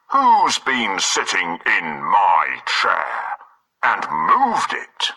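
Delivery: slow, deep big bear; drawn-out papa bear; Father Bear voice. Acted. Father Bear's line.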